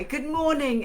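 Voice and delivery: ordinary talk, nothing marked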